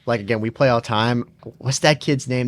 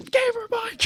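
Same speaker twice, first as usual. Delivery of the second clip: very high-pitched mock call; excited kid imitation; 4 dB quieter than usual. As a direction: squeal the name like a hyped kid. Imitating kid hailing him in game.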